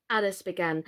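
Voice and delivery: ordinary delivery, nothing marked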